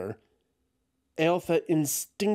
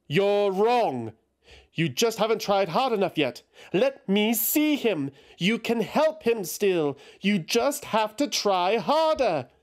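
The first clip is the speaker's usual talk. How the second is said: pleading Eothas; 8 semitones higher than usual. A narrator's reading. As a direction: push him to try harder.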